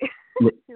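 short loud burst of laughter; embarrassed laugh. Real, not acted. Violinist laughing off her search for words. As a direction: laugh it off, a little sheepish. Laughs after apologizing for English.

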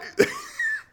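sharp high-pitched disbelieving giggle; squeaky high laugh. Real, not acted. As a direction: burst out laughing at the absurdity. Laughing at claim.

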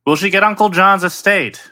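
normal talking voice

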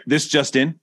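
newscaster voice. Pretend. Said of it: "Stop the press".

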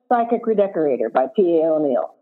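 ordinary delivery

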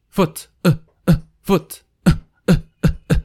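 foot then short pushed "uh" sounds; vowel drill. Shown as a demonstration. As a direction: repeat "uh" short and punched from the belly. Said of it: "suena un poco raro".

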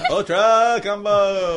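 slow, high booming game announcer. Pretend. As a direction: call it like a fighting game.

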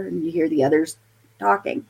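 normal talking voice

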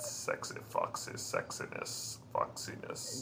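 low, soft breathy tease; sultry whisper. Pretend. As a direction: purr it seductively.

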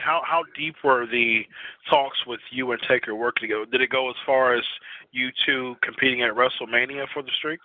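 ordinary talk, nothing marked

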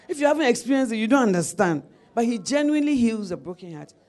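normal talking voice

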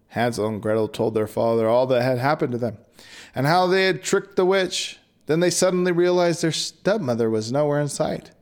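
ordinary delivery, nothing marked

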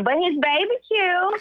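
high gushing cute. Real, not acted. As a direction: rise up fondly on cute. Gushing about baby.

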